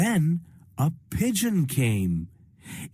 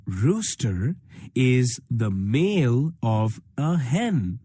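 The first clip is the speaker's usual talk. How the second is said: usual speaking voice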